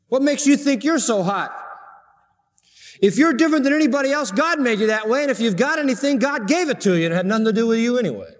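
high, rapid-fire pulpit rebuke; full-force preaching. Acted. Preacher as Paul confronting the proud Corinthians, full-force preaching. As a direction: challenge them in a high, driving preacher's voice.

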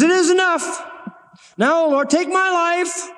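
high, slow, worn-out plea to die; despairing prophet. Acted. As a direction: Elijah at the end of his rope, begging God. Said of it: "that's what I call discouragement".

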